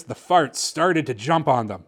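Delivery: ordinary delivery, nothing marked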